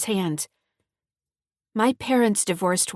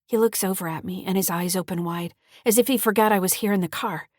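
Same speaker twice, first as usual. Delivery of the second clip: ordinary delivery, nothing marked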